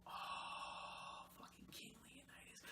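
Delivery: breathy whispered recall; whispering the name in recognition. Real, not acted. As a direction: whisper it under your breath. Recalling the movie.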